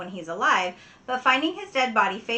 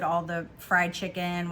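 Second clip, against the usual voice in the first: normal talking voice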